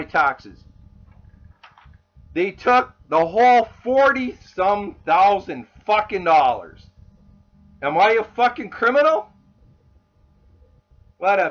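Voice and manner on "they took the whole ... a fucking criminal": loud, real indignation; angry rant building to an incredulous question; outraged. Real, not acted. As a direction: protest angrily, voice raised. Outraged at taxes being taken.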